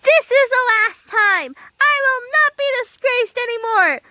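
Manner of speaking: high, indignant declaration; very angry little man. A narrator's reading. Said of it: "the little man was so angry".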